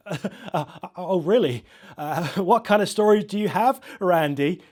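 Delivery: high, loud, chuckling through the words; host trying not to laugh at the caller; stifled laughter. Acted. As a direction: barely hold back the giggles.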